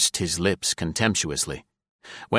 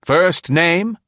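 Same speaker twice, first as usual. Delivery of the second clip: brisk questioning man; 10 semitones higher, 6 dB louder than usual. A narrator's reading. Caspier voiced.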